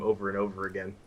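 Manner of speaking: ordinary talk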